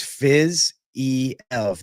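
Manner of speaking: Fizz-E-Ev in three slow, separated syllables, fizz, ee, ev. Shown as a demonstration. Streamer showing a viewer how he says the fighter's name, Fizz-E-Ev slowly in three separate syllables. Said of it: "But to me, it's Fizz-E-Ev".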